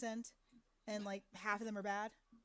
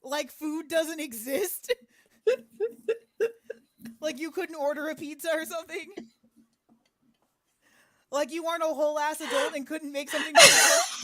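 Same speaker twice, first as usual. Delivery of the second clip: incredulous; 5 semitones higher, 12 dB louder than usual. Real, not acted. Incredulous teasing.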